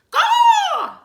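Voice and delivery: shrill squawking caw; loud bird caw; penguin caw. Acted.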